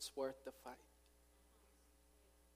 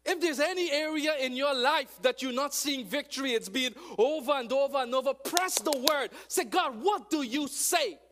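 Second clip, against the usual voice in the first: loud, high, rising sermon cry; full-force preaching; 11 semitones higher, 18 dB louder than usual. Real, not acted. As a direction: preach it hard to the crowd.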